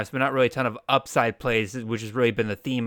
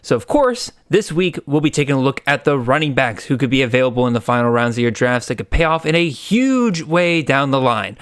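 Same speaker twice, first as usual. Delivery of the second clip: upbeat, louder show teaser; announcer voice; 5 dB louder than usual. Acted. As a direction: pitch the upcoming episode with energy. Podcast teaser intro.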